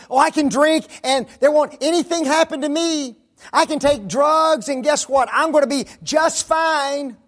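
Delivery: put-on high, loud careless young voice; mock cocky youth. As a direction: ham up a know-it-all kid. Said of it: "Individuals think".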